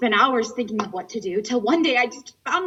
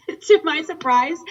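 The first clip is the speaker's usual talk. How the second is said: laughing; 4 semitones higher, 5 dB louder, slower than usual. Acted. Laughing at her own antics.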